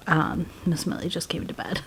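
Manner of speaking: hushed whisper so the cat sleeps; soft whisper, someone just fell asleep beside you. Real, not acted. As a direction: breathe the words, no voice.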